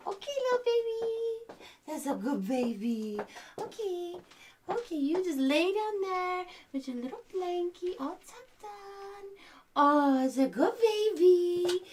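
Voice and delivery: hushed, high singsong soothing; soft baby cooing. Pretend. Demon mother tucking baby in, soft cooing. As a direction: coo gently, letting the vowels stretch.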